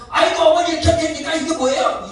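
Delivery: hammed-up exasperated complaint; mock rude scolding. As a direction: play the grown child scolding mom. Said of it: "이러지 말고".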